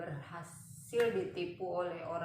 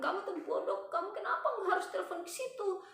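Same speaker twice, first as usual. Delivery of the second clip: very high, rapid mock scolding; retold anger; 12 semitones higher, faster than usual. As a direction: imitate an angry scammer in a high voice. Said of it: "Terus langsung dia marah".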